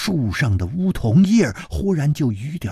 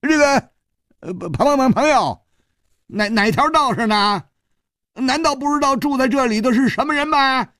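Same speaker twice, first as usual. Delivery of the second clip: much higher, stammering bluster; a frightened man shouting to seem brave; nervous loud challenge; 13 semitones higher than usual. Acted. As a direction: force courage out, voice cracking high. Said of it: "大声说".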